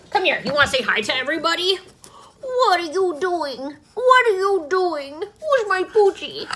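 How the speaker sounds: high sing-song pet voice. Real, not acted. Talking baby talk to her dog.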